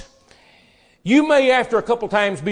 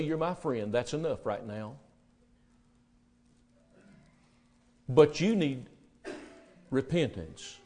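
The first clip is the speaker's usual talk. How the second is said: soft, lower reassurance; gentle Jesus voice; 3 semitones lower, 4 dB quieter than usual. Acted. Jesus reassuring ashamed Peter, soft and gentle. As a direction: speak it kindly, like forgiving a friend.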